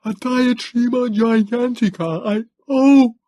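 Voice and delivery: high, loud, breaking into a cry; losing the words to terror; panicked cry. Acted. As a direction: Morlock in the beak, panicking. Morlock being dragged by monster.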